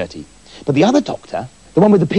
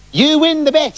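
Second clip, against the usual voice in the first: high, loud grudging concession; a much higher innkeeper voice, loud and a bit sour; 12 semitones higher, 4 dB louder than usual. Acted. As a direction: hand over the win with a forced grin.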